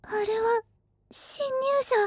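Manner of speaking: hushed, high-pitched alarm; frightened, under the breath. Acted. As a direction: gasp it softly on spotting intruders. Servant spots intruders at night.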